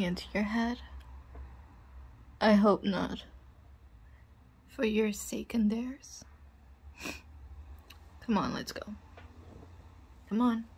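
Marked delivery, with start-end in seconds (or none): 4.7-6.2 whispering. Acted.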